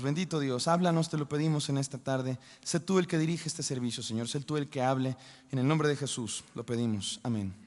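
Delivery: soft, low prayerful voice. Acted. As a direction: pray it quietly and reverently. Leads a prayer.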